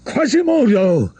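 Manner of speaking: high, loud cry of a name in distress; a priest pinned down cries for help. A narrator's reading. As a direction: call out in sudden panic.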